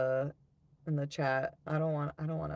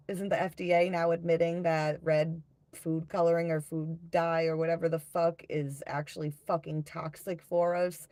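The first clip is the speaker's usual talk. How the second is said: ordinary delivery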